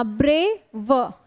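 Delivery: Brave broken into two slow beats, ब्रे - व; split in two. Shown as a demonstration. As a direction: say brave slowly with a stop before व. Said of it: "दो stop होते हैं".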